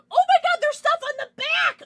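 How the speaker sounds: high, loud burst of delight; excited surprise. Real, not acted. Streamer finding stuff on a card's back, excited yell. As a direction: blurt it out at the top of the voice.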